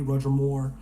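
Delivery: usual speaking voice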